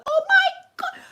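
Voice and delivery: high, loud laughing shriek; laughing panic squeal. Retold or imitated. Daughter's foot bleeding, panic.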